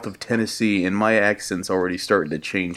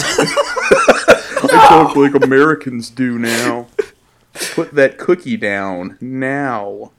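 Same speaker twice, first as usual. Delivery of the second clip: slow, loud hammed-up Austrian accent; mock Austrian Arnold; 3 semitones higher, 6 dB louder, much slower than usual. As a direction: drag out the words in a big Austrian Arnold accent. Imitating Arnold talking "American.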